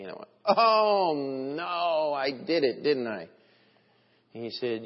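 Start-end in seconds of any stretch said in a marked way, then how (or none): 0.4-3.3 long drawn-out oh no, sinking to the end; dawning realization. Retold or imitated. Driver realizes the illegal turn, retold dawning dismay. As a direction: re-enact the penny dropping, sliding down in dismay. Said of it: "and then it hit me".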